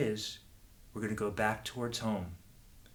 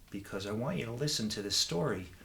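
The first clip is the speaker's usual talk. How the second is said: normal talking voice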